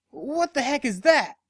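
startled. Acted. Dash sees sparks.

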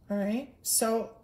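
ordinary talk, nothing marked

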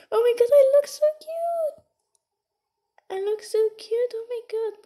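high, delighted squeal; excited gushing. Real, not acted. Thrilled at own baby look.

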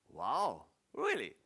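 genuinely taken aback, pitch leaps up; surprised. Real, not acted. Trainer surprised by the audience's answer.

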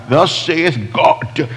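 slow, drawn-out, high-pitched prophetic pronouncement; hammed-up holy proclamation, words stretched out; mock prophet. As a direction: intone it like an over-the-top robed prophet. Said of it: "No, no, I'm talking like that".

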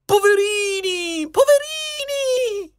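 high, drawn-out fake wail; badly faked sobbing, very high and slow; mock weeping. Jester pities the dead ministers, mock crying. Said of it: "finse di scoppiare in un pianto dirotto".

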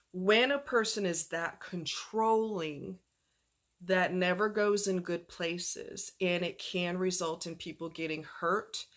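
deliberate cautionary delivery; slow, grave warning. Real, not acted. Warning, slowed for emphasis.

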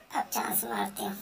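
sneaky whisper; cat whispering as she makes her move. Acted.

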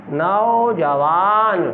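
नौजवान slow with a falling close; slow, settled नौजवान; slow final reading. Shown as a demonstration. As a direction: read नौजवान out slowly and end it down. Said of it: "teacher repeats word, falling close".

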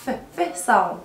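isolated "ف" sounds, short puffs of f; sound demonstration. Shown as a demonstration. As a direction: say "ف" alone as a short f, repeated. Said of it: "البي مع الاتش بتتنطق ف".

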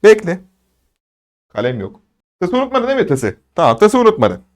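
ordinary delivery

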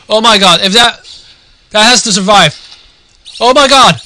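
high, loud shrieks of joy; ecstatic screaming. Real, not acted. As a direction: explode with delight at the play. Caster erupts at game-saving play.

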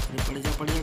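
normal talking voice

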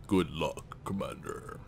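lightly voiced deep gravelly spokesman; imitating the spokesman. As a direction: try for the Council spokesman's low gravelly tone. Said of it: "I could try to imitate his voice".

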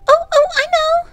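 put-on very high, loud girlish squeal; excited cry. A narrator's reading. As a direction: burst in with a sudden bright idea. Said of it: "cried Sorrel".

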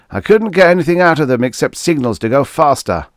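high, loud, exasperated complaint; querulous. A narrator's reading. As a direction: complain loudly with a querulous edge. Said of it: "querulous note in his voice".

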